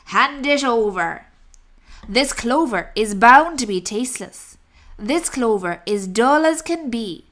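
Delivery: booming, demanding highway robber rat; greedy rat roaring at the rabbit for cakes; loud bullying rat. Acted. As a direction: bellow it like a greedy bandit. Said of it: "he ordered".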